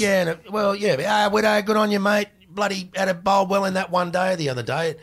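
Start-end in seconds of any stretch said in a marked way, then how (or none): 1.0-4.9 high, loud, matey fan greeting; cheery fan. Retold or imitated. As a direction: call out like a chuffed bloke at the bar. Fan greeting him at the pub.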